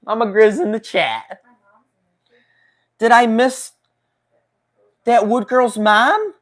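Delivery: high, loud, surprised greeting; excited shout-out. Real, not acted. Streamer spots a relative joining the chat, excited shout-out.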